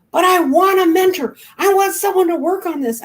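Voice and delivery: high, loud mock tantrum; angry whine. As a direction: stamp your foot like a furious child. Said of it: "she's never been so angry in her life".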